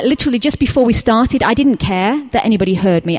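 normal talking voice

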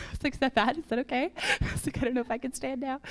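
soft, mock-worried voice through laughter; laughing worry. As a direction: giggle through a retold moment of doubt. Retold worry after knee pop, laughing.

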